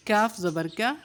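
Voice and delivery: usual speaking voice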